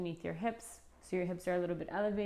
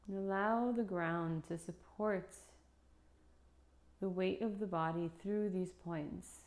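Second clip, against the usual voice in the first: guided meditation. Acted. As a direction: lead them gently into stillness, unhurried.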